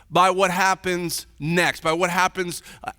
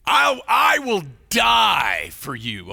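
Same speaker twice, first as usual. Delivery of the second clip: retold Peter vows to Jesus he'll die; passionate vow; slower than usual. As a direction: promise it with everything.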